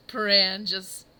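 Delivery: usual speaking voice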